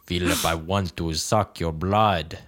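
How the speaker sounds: slow, low mock Transylvanian count; vampire accent. Host threatening Philip in a low vampire accent, slow. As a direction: menace the name like a hammy Dracula. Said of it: "a weird Russian, German accent".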